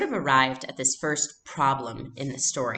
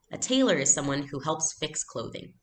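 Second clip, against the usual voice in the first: ordinary talk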